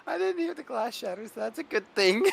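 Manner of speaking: high-pitched, talking through laughter; real amusement spilling into the voice; laughing. Real, not acted. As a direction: squeeze the words out between laughs. Laughing over the wreck joke.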